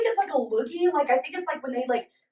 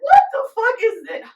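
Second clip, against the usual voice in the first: shocked outburst; 8 semitones higher, 4 dB louder than usual. Retold or imitated. Shocked at gun from shoe.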